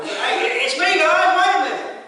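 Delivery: loud, high, playful paraphrase; Jesus calling over the storm to scared disciples, loud and breezy; casual Jesus. Pretend. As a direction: call out to the frightened guys. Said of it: "I like the way Scripture says it".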